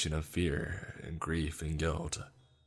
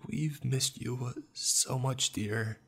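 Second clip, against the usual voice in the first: higher, drawn-out motherly sorrow; pained and sorrowful; 7 semitones higher, slower than usual. A narrator's reading. Mother greeting her long-lost child, pained and sorrowful. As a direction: ache slowly through each word in a higher voice. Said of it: "dripping with pain and sorrow".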